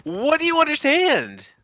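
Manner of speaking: high, slow taunting question; mocking high voice. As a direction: taunt him in a squeaky voice.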